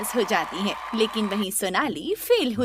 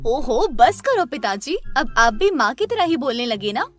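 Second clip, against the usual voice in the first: fed up, cut the father off; annoyed outburst; 5 dB louder than usual. Acted. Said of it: "गुस्से में सोनाली".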